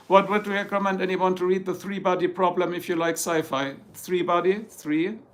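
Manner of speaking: usual speaking voice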